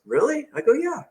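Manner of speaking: high, questioning disbelief; retold surprise.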